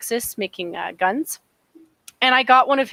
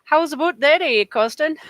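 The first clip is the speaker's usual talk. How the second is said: put-on Canadian accent; 6 semitones higher than usual. Shown as a demonstration. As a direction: play up a Canadian "aboot, eh". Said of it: "That's about as good as I do".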